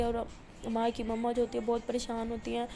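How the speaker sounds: ordinary delivery, nothing marked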